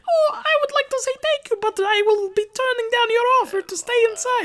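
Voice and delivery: squeaky falsetto way up high, politely formal; a mock falsetto gentleman declining politely; very high-pitched voice. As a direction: play Leatherman as a prim man with a tiny high voice. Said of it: "Or he had a really high-pitched voice".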